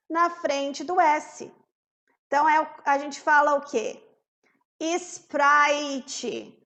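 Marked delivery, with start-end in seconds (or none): 4.8-6.6 "Sprite" said Brazilian-style as is-PRAI-tchi; added IS before S. Shown as a demonstration. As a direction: put an extra vowel before and after "Sprite". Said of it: "a gente põe um is". ("Sprite" aportuguesado, "isprаitchi")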